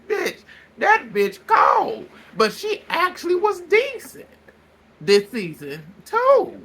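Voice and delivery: high, slow, sassy shade; sassy high drawl. Real, not acted. Sassy aside about London lady.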